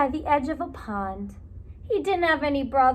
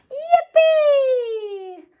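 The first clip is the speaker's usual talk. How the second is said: laughing joyful cheer; 11 semitones higher, 7 dB louder than usual. Acted. Otter cheers.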